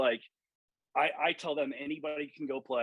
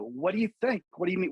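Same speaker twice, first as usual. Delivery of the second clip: higher and louder, half-indignant comeback; irate retort; 6 semitones higher, 4 dB louder, faster than usual. Retold or imitated. Golf coach retelling confronting the starter, indignant raised voice. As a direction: snap back at the starter, offended.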